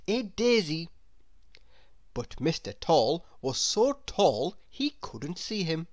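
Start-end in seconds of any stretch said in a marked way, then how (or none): none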